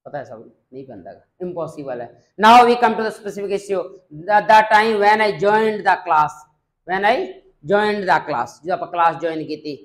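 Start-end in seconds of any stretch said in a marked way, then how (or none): 2.4-8.6 slow, louder, carefully paced English; model speech demo. Shown as a demonstration. Teacher models an English answer for students, slow formal delivery. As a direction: read it out as the model answer for the class.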